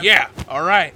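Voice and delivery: loud, rowdy chorus of agreement; the gang calls out yeah together; crew agreeing. Acted. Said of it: "kind of in agreement".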